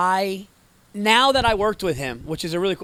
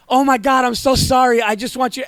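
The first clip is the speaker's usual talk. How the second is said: hammed-up pleading, high and loud; frantic apology; 7 semitones higher, 8 dB louder than usual. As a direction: beg forgiveness in mock panic. Retells frantic apology for wrong emoji.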